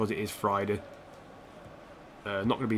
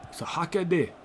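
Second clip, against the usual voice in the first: higher-pitched mock Scottish accent; playful Scottish lilt, pitched up; 6 semitones higher than usual. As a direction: slip into a put-on Scottish accent. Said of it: "doing it in a Scottish accent".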